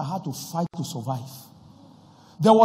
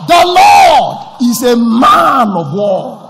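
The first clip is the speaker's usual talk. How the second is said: booming, much louder proclamation; preaching at full force; 10 semitones higher, 17 dB louder than usual. Real, not acted. Pastor declares victory over the congregation, thunderous preaching.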